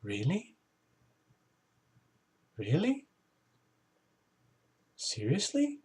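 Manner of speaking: soft, breathy-free hushed questions, almost under the breath; a small stunned voice asking if it's true; hushed disbelief. Pretend. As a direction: wonder quietly if it's real. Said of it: "looking around like going".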